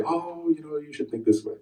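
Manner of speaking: soft, gentle reassurance; hushed, well-meaning counsel; gentle advice. Retold or imitated. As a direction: speak softly, as if soothing someone upset. Gently advising the angry emailer.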